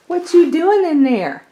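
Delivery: high, loud sing-song pet voice. Real, not acted. Baby talk to the pet.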